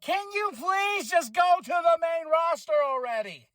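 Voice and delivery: high pleading exasperation; exasperated plea. Retold or imitated. Fan begging Braun to move up, high exasperated plea. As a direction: beg him, fed up. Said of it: "I was like".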